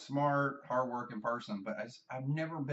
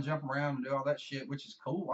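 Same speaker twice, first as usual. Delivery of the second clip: normal talking voice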